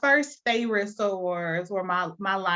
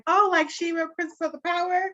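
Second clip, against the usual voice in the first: higher-pitched mock excited question; imitating people's recognition; 8 semitones higher, faster than usual. Guest imitating people hearing her name, excited recognition. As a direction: mimic someone brightly making the connection. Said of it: "people would be like".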